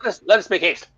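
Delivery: put-on high, hurried, excited old fisherman; eager fisherman. Fisherman hurrying the party aboard after the gold, excited and high. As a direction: rush them aboard now that he's paid.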